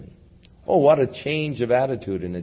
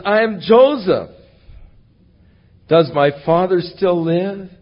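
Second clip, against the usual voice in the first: emotional cry; 7 semitones higher, 6 dB louder than usual. Acted. Joseph reveals himself to brothers.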